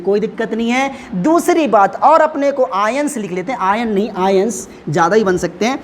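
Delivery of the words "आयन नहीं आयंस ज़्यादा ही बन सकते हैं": ordinary talk, nothing marked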